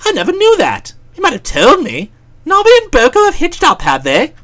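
high amazed exclaiming; astonished. Acted. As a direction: gasp out the news. Bertie astonished.

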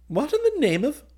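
high, loud, bewildered exclamation; startled outburst. A narrator's reading. Officer reacting to a midnight meeting request, incredulous. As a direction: burst out in disbelief, then break off. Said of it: "he began, but checked himself".

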